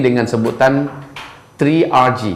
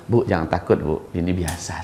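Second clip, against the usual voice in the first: low, soft calming voice; calm a frightened wife, low and soft; retold reassurance; 7 semitones lower, 9 dB quieter than usual. Menenangkan istri pasien yang takut. (menenangkan dengan suara rendah dan lembut)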